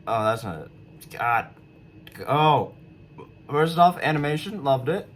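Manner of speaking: ordinary talk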